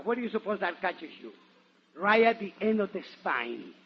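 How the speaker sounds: ordinary talk, nothing marked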